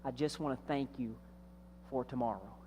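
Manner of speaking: put-on a child's hushed, heartfelt thank-you; very gently. Little boy thanks his daddy at night, softly and tenderly. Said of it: "The little boy very gently said".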